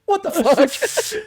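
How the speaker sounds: very high, slow, drawn-out squeak; slow high laughing outrage; stunned disbelief. Retold or imitated. Reacting to ship crash.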